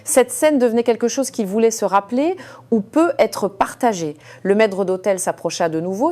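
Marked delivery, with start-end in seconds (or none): none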